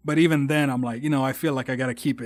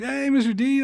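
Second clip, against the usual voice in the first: much higher and louder, eager greeting; excited hello; 11 semitones higher, 4 dB louder than usual. Retold or imitated. Former student greeting band director, high and eager. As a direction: greet your old teacher with delight. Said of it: "I was like".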